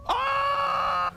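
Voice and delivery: high shriek of fright; startled scream. Real, not acted. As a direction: shriek in real panic. Jump scare.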